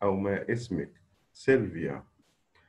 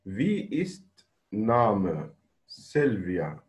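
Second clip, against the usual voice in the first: slow reading; much slower than usual. Shown as a demonstration. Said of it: "شوف نمرة تمانية".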